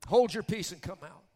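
forceful rebuke in Jesus' voice; commanding rebuke. Retold or imitated. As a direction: order the spirit out with authority. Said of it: "Jesus would say".

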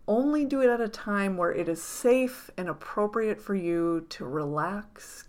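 ordinary delivery

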